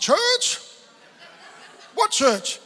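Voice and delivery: high, loud incredulous yelp on church; can't believe his ears, laughing; half-laughing shocked question; astonished. Retold or imitated. Retold astonishment at sister's church.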